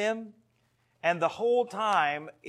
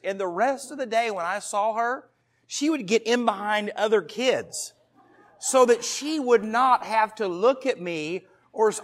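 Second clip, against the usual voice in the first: ordinary delivery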